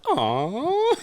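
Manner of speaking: melting high-pitched aw; drawn-out doting aw; high cooing "aw". Retold or imitated. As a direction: coo it as at a cute pet. Bird's antics make him go aw.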